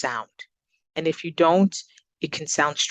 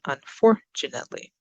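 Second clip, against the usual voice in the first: unfortunately with FOR punched; teacher's repeat of un-FOR-tunately; stress on second syllable. Shown as a demonstration. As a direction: lean hard on FOR in unfortunately. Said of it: "up on the second".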